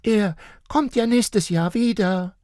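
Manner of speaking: put-on high child voice; promising child. A narrator's reading. Little girl promising her sad parents, high. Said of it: "versprach Marla".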